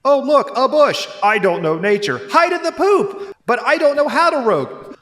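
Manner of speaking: high, hammy school-play lines; silly kid actors. Retold or imitated. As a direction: overact like a child on stage. Kids acting clumsily.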